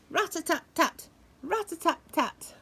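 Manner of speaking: high quick rat-a-tat-tat; woodpecker tapping. Retold or imitated. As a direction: peck it out fast. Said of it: "He goes".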